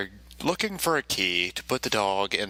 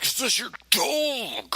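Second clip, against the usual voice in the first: high, breathy put-on cop; imitating the police officer; mock cop voice; slower than usual. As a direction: voice the cop as a squeaky caricature. Cop questions him, voiced.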